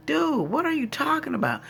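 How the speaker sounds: high-pitched incredulous comeback; retold disbelief. Woman retells answering the man who doubts her meeting, incredulous.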